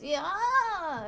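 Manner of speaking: high, loud, drawn-out comic yell; acted scream. Devil fleeing into the night, acted scream. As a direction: yell it out like a fleeing cartoon villain. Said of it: "And then he screams".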